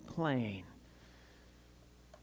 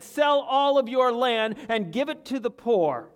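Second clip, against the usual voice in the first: raised voice, high and loud, laying down the demand; loud commanding call; 8 semitones higher, 9 dB louder than usual. Acted. Preacher to congregation, loud commanding quote. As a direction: proclaim it like a stern order.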